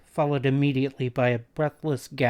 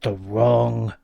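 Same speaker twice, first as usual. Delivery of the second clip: slow menacing repeat; 3 dB louder, slower than usual. A narrator's reading. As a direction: spell it out slowly, menacing. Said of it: "the man repeated".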